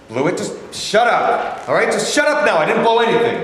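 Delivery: high, loud furious yelling; angry shout. Acted. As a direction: blow up and shout her down. Steve explodes at nagging wife.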